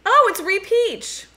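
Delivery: high, loud, drawn-out delight; real excited announcement, stretched out; excited recognition. Real, not acted. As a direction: greet a friend's arrival with a slow, rising squeal. Spots a friend joining the chat.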